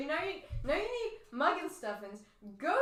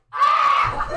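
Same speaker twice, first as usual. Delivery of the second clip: sudden piercing screech right in the ear; 16 dB louder than usual. Acted. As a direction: shriek it at full force. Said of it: "screeched in my ear".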